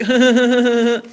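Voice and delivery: drawn-out cackle; rattling, derisive chuckle; mocking laugh. Real, not acted. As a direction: laugh through a long string of ha-ha-has. Mocking laugh at ex's quote.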